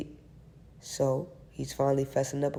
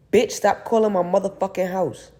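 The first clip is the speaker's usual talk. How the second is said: angry shout; 5 semitones higher, 7 dB louder than usual. Acted. Hangs up on caller.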